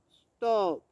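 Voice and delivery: low, loud bear growl; growled stop. A narrator's reading. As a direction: growl it at the cheerful mouse. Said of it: "growled Bear".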